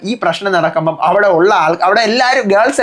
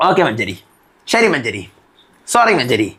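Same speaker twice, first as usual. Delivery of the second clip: sheepish apology; 9 semitones lower than usual. Retold or imitated. Arun backs down to the captain.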